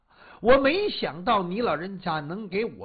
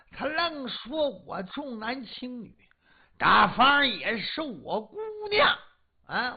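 ordinary delivery

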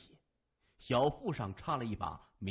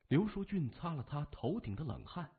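plain reading voice

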